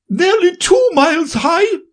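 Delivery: shrill high queen; imperious queen, very high. Acted. Queen adds.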